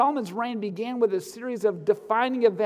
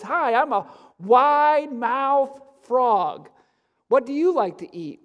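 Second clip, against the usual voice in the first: stretched-out, higher, louder frog voice; wide-mouthed frog; 4 semitones higher, 4 dB louder, slower than usual. Acted. Frog greeting a squirrel in a family joke, drawn-out comic voice. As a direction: draw out each word like a big-mouthed frog.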